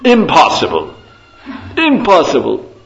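loud, high upper-class British exclamation; British accent. Retold or imitated. Lecturer mimics Lord Zetland refusing the rules, loud British accent. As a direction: blurt it out like an outraged English lord. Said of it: "in his, in his best British accent".

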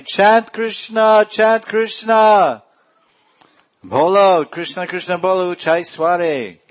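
high, drawn-out shout; very loud call. Acted. Gauranga urging all to chant Krishna's name, shouted very loudly. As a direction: cry it out, stretching the words. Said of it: "He said very loudly".

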